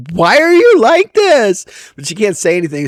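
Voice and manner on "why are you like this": high, slow mock-exasperated wife; wife's exasperated look voiced. Husband imitating his wife's exasperated stare. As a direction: play the baffled wife, drawn out. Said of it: "my wife looks at me like".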